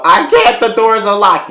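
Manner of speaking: high, loud mock panic; hammed-up helpless shriek; panicked protest. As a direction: yell back in frantic desperation. Acting trapped woman's frantic reply.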